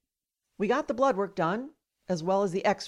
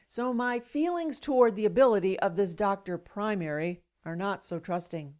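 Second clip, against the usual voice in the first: ordinary delivery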